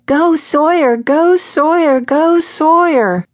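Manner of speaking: high-pitched, drawn-out fan chant; cheerleader yell. A narrator's reading. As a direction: cheer him on like pom-pom girls, high and slow. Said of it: "shook their pom-poms and yelled".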